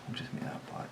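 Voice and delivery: soft whisper to himself; whispered aside. Real, not acted. Artist whispering to himself while inking. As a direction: murmur it under the breath.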